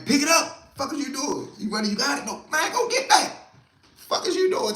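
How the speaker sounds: high, loud impatient yelling; acted yelling. Yelling at a guy who dropped the gun, high and loud. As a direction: bark at him to pick it up, mock fury.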